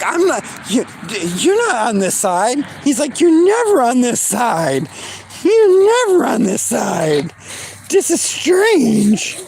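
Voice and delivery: high, puzzled doggy voice; playful dog voice, slowing on the last line; voicing the dog. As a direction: speak for the confused dog. Dog's voice, mock puzzled.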